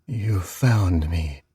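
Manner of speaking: very slow, low ghost whisper; bone-dry whisper. Acted. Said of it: "a bone-dry whisper".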